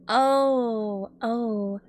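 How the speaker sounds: ordinary talk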